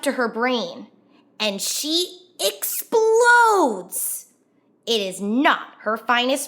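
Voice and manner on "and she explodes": slow, high, loud burst with a cry; a higher, drawn-out outburst with a cry; exploding. A narrator's reading. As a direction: let the anger burst out, slowly. Narrating her outburst of anger.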